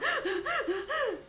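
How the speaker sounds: high, nervous panicked squeal; mock panic yelp. A swimmer squealing as water reaches her face, panicked. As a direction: squeal like you're scared of the water. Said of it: "I'll go".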